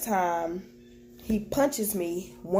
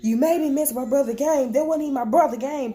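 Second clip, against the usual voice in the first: retold husband's angry outburst, higher and louder; angry yell; 6 semitones higher, 4 dB louder than usual. Husband yelling at wife in the car, angry shout. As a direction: snap it at her like he's furious.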